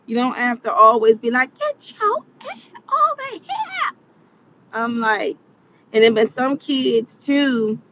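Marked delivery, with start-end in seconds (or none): 1.6-4.0 very high, strained yell held down in volume; high-pitched imitation scream; mock screaming mom. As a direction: mimic a mother screeching at her kid. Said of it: "scream all the time".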